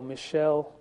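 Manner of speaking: Michelle said as "mi-SHELL"; alternative pronunciation. Shown as a demonstration. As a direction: say Michelle with a soft sh, stressed at the end. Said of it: "How shall we pronounce that?".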